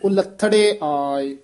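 ਉਲੱਥੜੇ slow, with the ਊੜਾ sound lifted and tight. Shown as a demonstration. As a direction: say ਉਲੱਥੜੇ slowly and firmly, ਊੜਾ held taut. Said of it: "ਊੜੇ ਤੋਂ ਚੱਕ ਕੇ ਉਚਾਰਨ ਕਰਨਾ ਹੈ, ਕਸ ਕੇ". (ਉਲੱਥੜੇ ਕਸ ਕੇ ਤੇ ਹੌਲੀ ਬੋਲਣਾ)

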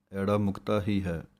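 ordinary delivery

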